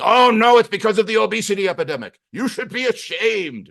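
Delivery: loud, high mock scolding; scolding doctor. Doctor blames patient for obesity epidemic, mock scolding. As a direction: shame them in an exaggerated high voice.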